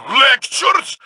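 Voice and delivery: high, loud outburst; indignant shout; scornful shout. Acted. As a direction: throw the word back in scorn. Angry retort to Savathun.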